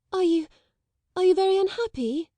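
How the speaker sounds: put-on high, hurried blurting girl; in a rush. A narrator's reading. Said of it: "she said in a rush".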